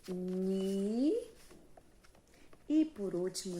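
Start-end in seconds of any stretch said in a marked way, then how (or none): 0.1-1.1 "ni" held long on its own; teacher's long, separate "ni"; isolated syllable. Shown as a demonstration. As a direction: sound out "ni" as one drawn-out syllable. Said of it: "Depois eu digo".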